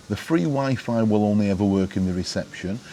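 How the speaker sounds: normal talking voice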